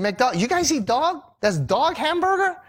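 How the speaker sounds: high, rising incredulous cry; appalled tourist, high and shocked; shocked disbelief. Retold or imitated. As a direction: squeal it out as if you can't believe it. Shocked at eating dog.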